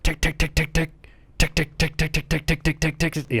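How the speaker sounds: high, rapid tick tick of bullets hitting the dirt; rounds ticking. Retold or imitated. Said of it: "all you can hear was".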